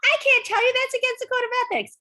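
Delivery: high, fast mock of a smug agent; stupid agent. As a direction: parody a clueless agent citing the rules. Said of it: "the agent's gonna be stupid and say".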